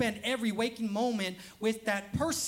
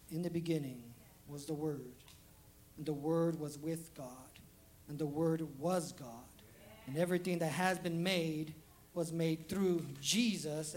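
scripture recitation; 5 semitones lower, 5 dB quieter than usual. Acted. Reciting John one scripture.

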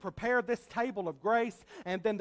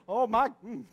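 usual speaking voice

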